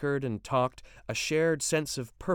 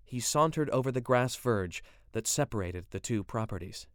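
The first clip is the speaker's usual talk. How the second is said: usual speaking voice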